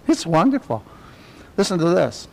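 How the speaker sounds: ordinary talk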